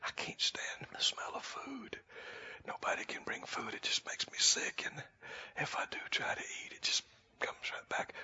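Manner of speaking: imitating a sick woman's whisper; barely voiced, very soft confession. Sick sister confiding she can't stand food, whispered. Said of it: "she whispered, she said".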